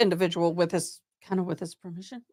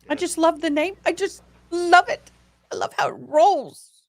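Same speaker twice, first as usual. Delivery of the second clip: delighted; 8 semitones higher than usual. Real, not acted. Gushing over a member's name.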